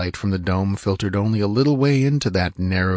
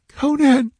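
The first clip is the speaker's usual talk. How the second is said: very high, more urgent call; whimpered plea; 5 dB louder than usual. A narrator's reading. Frightened woman calling her hidden ally a second time, urgent whimper. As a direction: whimper the name louder, pleading. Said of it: "she whimpered faintly".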